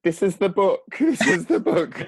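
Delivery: high, laughing excitement; excited. Retold or imitated. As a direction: gush over the book with a laugh. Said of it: "I was like".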